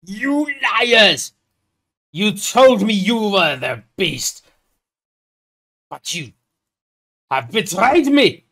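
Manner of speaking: loud, high, drawn-out accusing cry; hammed-up roar of betrayal; betrayed outrage. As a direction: declaim it slowly at full voice, wounded and furious. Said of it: "Gerard, on the meanwhile, goes".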